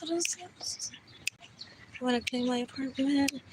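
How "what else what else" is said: whispered repeats. Acted. Whispering the refrain to herself. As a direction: drop to a whisper.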